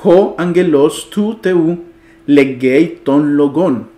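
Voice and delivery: slow, segmented Greek reading; example sentence read-out. Shown as a demonstration. Teacher shows students an example sentence, slow read-out. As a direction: read each Greek phrase slowly, with pauses. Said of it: "Vamos a ver un ejemplo".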